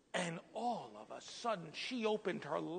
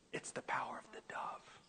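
hushed, voiceless whisper; imitated whisper; 5 dB quieter than usual. The Lord whispering in a pastor's ear, hushed whisper. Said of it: "what the Lord whispered in my ear".

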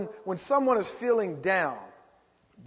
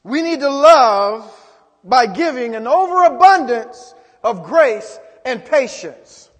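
emphatic preaching shout; 4 semitones higher, 11 dB louder than usual. Shown as a demonstration. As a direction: drive it home to the congregation. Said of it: "Come on, some of us need to look inward".

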